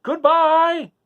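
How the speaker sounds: the wizard calling goodbye as he floats away. A narrator's reading. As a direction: shout it down from the sky. Oz calls farewell from balloon.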